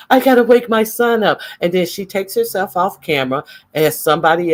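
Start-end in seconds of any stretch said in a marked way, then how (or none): 0.1-1.4 high, louder mock of her; hammed-up high-pitched mimicry; mocking quote. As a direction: say it like a flustered mom. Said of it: "And then she says that".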